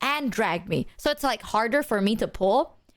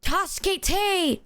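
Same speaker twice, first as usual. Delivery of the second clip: slow, high, stretched-out plea Tasukete; dramatic cry for help; 8 semitones higher, much slower than usual. Retold or imitated. Tourist calling for help in Japanese, slow high dramatic plea. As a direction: wail it like an anime heroine. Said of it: "I was out here being like".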